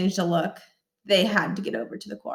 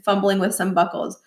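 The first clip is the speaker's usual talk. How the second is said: ordinary delivery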